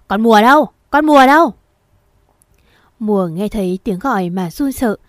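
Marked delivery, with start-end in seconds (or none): 0.1-0.7 loud yell calling for Mùa. Acted. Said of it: "mồm gào to". 0.9-1.5 full shout, pitched much higher; louder, higher yell. Acted. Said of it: "mồm gào to".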